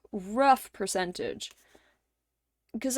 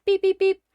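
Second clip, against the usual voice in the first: high, louder beep beep beep imitation; door alarm beeps; 9 semitones higher, 5 dB louder than usual. Mimicking the garage door alarm, a high beeping. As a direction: chirp it like an alarm.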